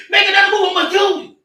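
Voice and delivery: high, loud shouted threat; retold cop screaming a death threat; cop's threat shout. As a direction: yell it like a cop ready to shoot.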